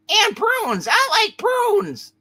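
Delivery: very high, loud cartoon voice, drawn out; high-pitched comic enthusiasm; excited prune lover. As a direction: squeal it slowly with delight. Figure butts in about prunes.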